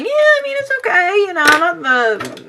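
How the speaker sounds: high, sing-song polite "it's okay"; pretending to be pleased with the haircut; fake polite approval. Fake-polite praise to hairstylist.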